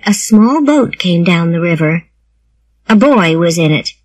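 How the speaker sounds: ordinary delivery, nothing marked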